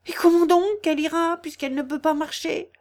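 high, incredulous challenge; objecting villager. A narrator's reading. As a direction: squabble like an excited villager.

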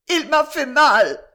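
high, loud, drawn-out wail; a loud, high-pitched tearful complaint. A narrator's reading. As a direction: cry it out slowly, hurt and tearful. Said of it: "répondit Maria en pleurant".

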